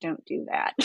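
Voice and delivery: soft, voiceless warning; low-volume, whispery telling-off; hushed teacher's reproof. Retold or imitated. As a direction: quietly imitate a disapproving teacher. Quoting her teacher's disapproval in class.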